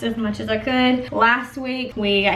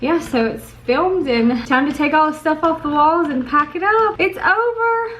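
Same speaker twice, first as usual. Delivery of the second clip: teary; 6 semitones higher than usual. Real, not acted. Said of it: "I'm gonna keep crying".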